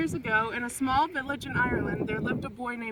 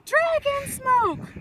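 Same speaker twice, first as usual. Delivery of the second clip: high, slow drawn-out cry; spooky dragon call; 13 semitones higher, 5 dB louder, much slower than usual. A narrator's reading. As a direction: wail it like a ghostly warning. Brothers fake a dragon alarm.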